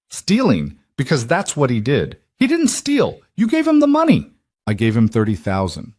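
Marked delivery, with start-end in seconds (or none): 0.1-4.3 heated argument. Acted. Daughter argues, mother replies.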